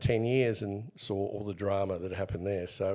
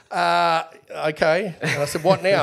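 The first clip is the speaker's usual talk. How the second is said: flustered surprise; 7 semitones higher, 9 dB louder than usual. Retold or imitated.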